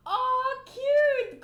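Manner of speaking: very high, loud squeal; a fan squealing at a panel; delighted squeal. Real, not acted. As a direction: squeal like you saw a puppy.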